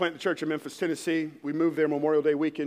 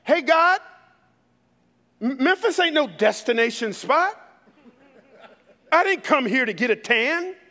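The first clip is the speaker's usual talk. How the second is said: raised, high-pitched gripe at heaven; loud complaint to God; 6 semitones higher, 6 dB louder than usual. Acted. Pastor reenacting his desperate prayer to God, loud complaint. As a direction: cry out in indignant prayer. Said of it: "I'm talking out loud".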